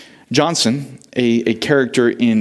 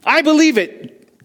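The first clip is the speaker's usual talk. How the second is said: put-on higher, insistent boy's protest; the boy insisting on his faith, much higher; defiant boy. A narrator's reading. Preacher voicing the orphan boy against Shepherd, defiant and higher. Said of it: "Johnson said".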